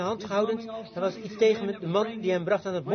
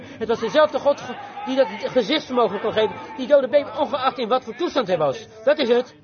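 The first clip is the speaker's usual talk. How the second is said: loud, raised preaching voice; fervent preaching; 5 semitones higher, 6 dB louder than usual. Real, not acted. Preacher urging the crowd to believe, fervent preaching. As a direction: drive the point home at full force.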